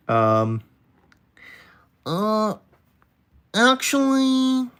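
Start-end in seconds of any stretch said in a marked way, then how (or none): 2.1-4.7 very high, drawn-out "actually"; nerdy know-it-all. Acted. Streamer testing a know-it-all voice on chat, very high "actually". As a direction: correct someone like an insufferable nerd. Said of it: "cycle through voices".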